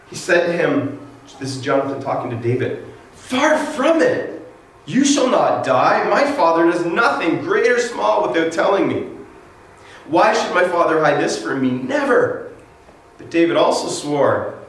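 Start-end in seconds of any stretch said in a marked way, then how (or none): 3.1-12.5 high, insistent denial. Acted. Jonathan reassures David, high and insistent. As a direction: brush off his fear, emphatically.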